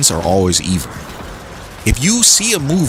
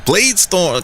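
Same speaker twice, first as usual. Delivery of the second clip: cheering; 7 semitones higher, much slower than usual. Real, not acted. Cheering his ability in game.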